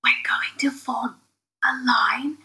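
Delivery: slow, conspiratorial whisper. Acted. Lining children up for crystals, slow whisper. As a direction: whisper instructions to the little ones, slowly.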